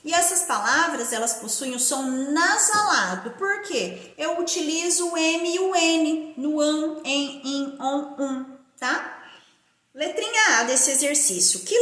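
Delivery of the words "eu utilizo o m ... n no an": normal talking voice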